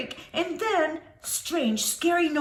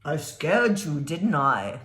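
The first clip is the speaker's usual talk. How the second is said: low man voice; 12 semitones lower than usual. Acted. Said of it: "he asked".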